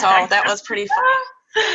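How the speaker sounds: laughing while recounting fun. Real, not acted. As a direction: giggle as you say it. Laughing about handshake practice.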